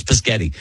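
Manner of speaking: "Pischetti" starting puh-sketty; a small child's word, "Pischetti"; child's mispronunciation. Shown as a demonstration. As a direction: jumble spaghetti into "Pischetti". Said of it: "I did mispronounce Pischetti".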